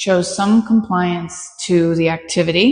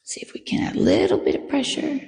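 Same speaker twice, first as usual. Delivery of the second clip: whispered aside; 6 semitones higher, 6 dB quieter than usual. Real, not acted. Aside while training.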